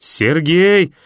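high, loud call for Sergei; yelling a name. A narrator's reading. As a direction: shout to reach him. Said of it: "заорал я".

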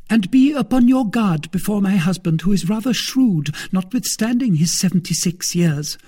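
high, loud female character voice; cautioning wife. Acted. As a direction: warn the suitor as a conspirator. Wife warns Porthos.

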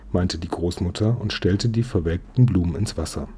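ordinary delivery, nothing marked

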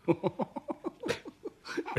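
high delighted laugh; a quick amused laugh. Acted. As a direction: burst out laughing at the trick. Laughing at the staged trick.

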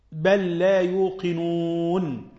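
slow, drawn-out recited verse; Quran recitation. Acted. Teacher reciting a verse to students, slow chant.

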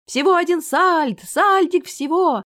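high, loud market barker's cry; drawn-out sing-out of a huckster's pitch; fairground barker. A narrator's reading. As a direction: call it out to draw in the crowd. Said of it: "Тощий зазывал уже не горланил своё".

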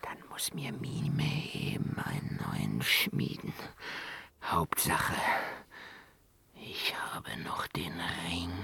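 scheming whisper. Acted. Dwarf whispering to himself about the ring. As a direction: reassure yourself secretly.

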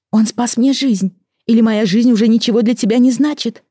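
high, hurt, indignant reproach; heated reproach. Acted. Amy argues heatedly with husband. (обиженный упрёк, голос выше)

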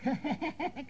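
high staccato mock laugh; imitated chuckle. The old man reacting at once, in an imitated high chuckle. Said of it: "पण त्यांनी लगेच".

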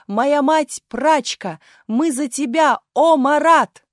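high, loud declamation building to a shout; full-force child's cry. A narrator's reading. Little actress pledging loyalty to Marat, crying out. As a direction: proclaim it with all your might. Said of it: "что есть силы крикнула Галя".

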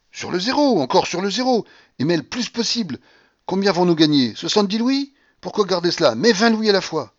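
high, pressing commands; eager ordering. A narrator's reading. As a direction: push excitedly for a bigger stake. Gambler eagerly ordering bigger bets.

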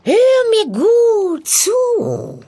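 high, slow, drawn-out witch voice; croaking witch, instructing. Acted. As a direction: lay out the task as a wily old hag, stretching key words. (krächzende Hexe, langsam und eindringlich)

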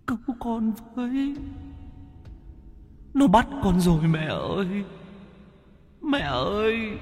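put-on faint, wailing child's plea; tearful pleading. A narrator's reading. Captive son begging his mother for help in her dream, tearful pleading. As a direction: cry out weakly for mother, voice drifting away. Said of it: "nhìn mẹ mếu máo".

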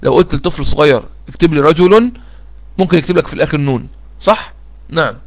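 ordinary delivery

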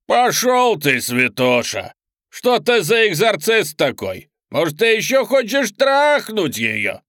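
loud, high, slow demonic taunt; snarling demon. Acted. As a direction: spit it out like a demon. Demon mocks the priest. (глумливый крик демона)

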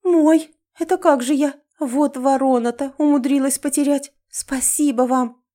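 high, loud startled cry, then grateful; gasp, then thank him warmly; gasping in surprise. Acted. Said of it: "ахнула".

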